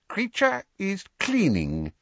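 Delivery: high, shifty elf reply; evasive. A narrator's reading. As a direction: dodge the question like a sly servant. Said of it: "he said evasively".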